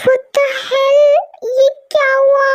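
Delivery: high, startled outburst; shocked. Acted. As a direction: caught off guard, blurt it. Shocked at drunk sister-in-law.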